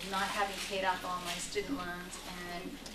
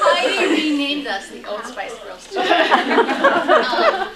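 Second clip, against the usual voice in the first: laughing; 8 semitones higher, 18 dB louder, much slower than usual. Real, not acted. Punchline of the sister joke.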